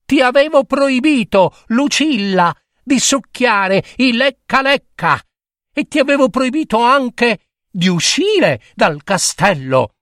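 high, stern scolding father; scolding king. Acted. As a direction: tell off the little princess. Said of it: "la sgridò".

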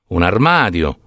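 usual speaking voice

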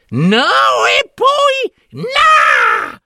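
high screech stretched into a scream; defiant hag. A narrator's reading. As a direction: refuse with a long shrieked ending. Hags refuse, ending in a scream.

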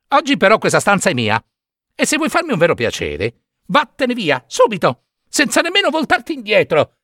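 put-on high, fast boyish puppet voice; cheeky puppet. Pinocchio shoos the cricket away, high and fast.

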